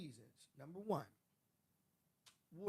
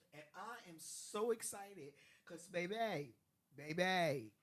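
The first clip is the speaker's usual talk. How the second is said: excited; 3 semitones higher, 11 dB louder, slower than usual. Real, not acted. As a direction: hype it up slow. Excited hype about the fest.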